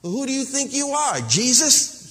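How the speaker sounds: imitated taunt; mock critic. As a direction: sneer it like an accuser. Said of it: "people say to me when they're mad".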